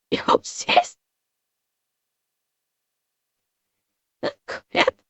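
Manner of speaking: whispered, tear-choked words; barely getting words out through tears; softly broken by crying. Real, not acted. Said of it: "can't talk about this subject without crying".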